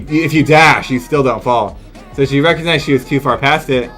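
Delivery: usual speaking voice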